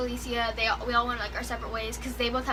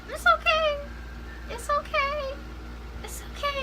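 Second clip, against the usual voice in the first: mom comforting, lightly voiced. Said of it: "Mom was like".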